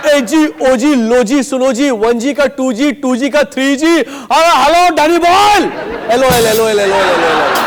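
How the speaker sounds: sing-song Anil Kapoor hero call; Anil Kapoor impression. Anil Kapoor calling out to Danny boy, loud and playful. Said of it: "Anil Kapoor".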